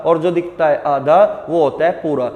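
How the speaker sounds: usual speaking voice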